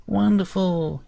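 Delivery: high, shrill delighted cry; shrill sprite. Acted. Sprite chief thrilled the hero agreed, shrill and high. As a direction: squeal with tiny joy. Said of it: "he says in a shrill voice".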